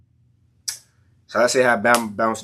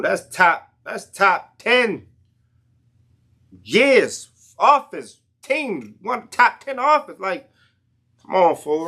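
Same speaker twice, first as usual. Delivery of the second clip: higher and slow, stretching the words; pitched-up hype, drawn out; hyped up; 8 semitones higher than usual. Real, not acted. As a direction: rave about the glory days. Hyping Jameis era.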